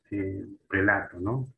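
usual speaking voice

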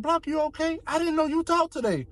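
high, bright mock coworker greeting; imitating coworkers. Coworkers greeting Block as he storms in, mock high voice. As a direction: chirp it like surprised coworkers.

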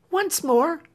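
high, pressing plea; urging. A narrator's reading. Clemency urging Marian to wait, high and pleading. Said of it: "urged Clemency".

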